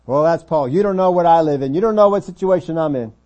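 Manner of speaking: loud, high protest; heated excuse-making; indignant objection. Acted. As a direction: argue back as a put-upon complainer. Preacher acts out a listener's objection.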